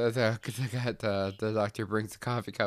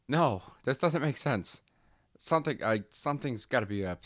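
higher, louder disbelieving cry; the doctor recoils from the talking cup, higher and louder; alarmed doctor; 5 semitones higher, 4 dB louder than usual. Acted.

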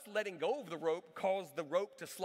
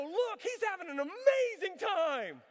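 high, loud, slow gleeful shout; big brother cheering the airborne kid; excited cheer; 12 semitones higher, 6 dB louder, slower than usual. Retold or imitated. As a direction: cheer up at the sky, oblivious.

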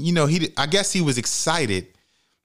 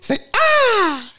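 high laughing prompt; excited high call; 5 dB louder than usual. Real, not acted. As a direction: sing out the cue, laughing. Prompting child to vocalize.

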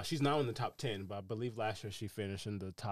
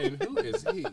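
high, loud mock-challenging father; indignant dad, imitated; 10 dB louder than usual. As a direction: play the affronted dad, pitched way up. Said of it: "her dad turned around like".

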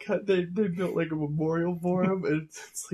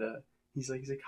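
usual speaking voice

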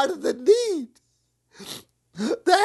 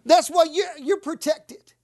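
normal talking voice

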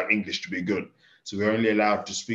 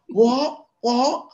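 mock confused "What? What?" pitched far higher; baffled pub stranger; 6 dB louder than usual. Stranger at the pub failing to understand his dad, mock baffled squeak. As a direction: squeak it out like someone who can't follow a word. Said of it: "someone was like".

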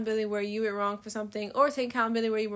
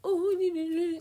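high mocking moan; put-on downer "oh", pitched up; Debbie Downer; 8 semitones higher than usual. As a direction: mimic a Debbie Downer's whining. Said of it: "I hate Debbie Downers".

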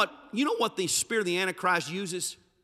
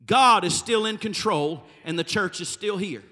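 ordinary talk

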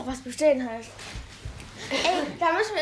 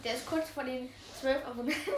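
ordinary talk, nothing marked

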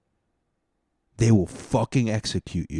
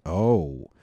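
ordinary delivery

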